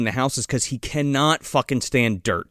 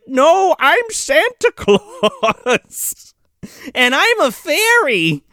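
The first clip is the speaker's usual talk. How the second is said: high, long drawn-out proclamation; exasperated mock protest; 10 semitones higher, much slower than usual. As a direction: stretch the name out in comic insistence. Captives protest who they are.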